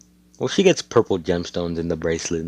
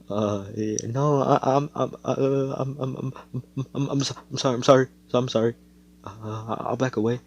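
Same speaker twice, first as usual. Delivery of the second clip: higher, stammering mock apology; mock scared stammer; 6 semitones higher than usual. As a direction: fumble over the words like a cornered kid. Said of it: "Seki's like".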